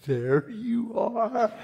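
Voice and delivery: soft, drawn-out playful greeting; playful cooing. Acted. Preacher acting out greeting God in his mirror, playful cooing.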